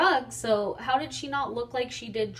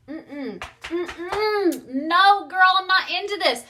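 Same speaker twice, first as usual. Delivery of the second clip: firm refusal; 9 semitones higher, 6 dB louder, much slower than usual. Retold or imitated. Refusing friend's car theft.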